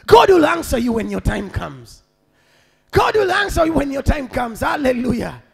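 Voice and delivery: loud proclamation falling at each close; emphatic preacher's refrain; forceful preaching. Acted. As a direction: declare it as a promise to the congregation.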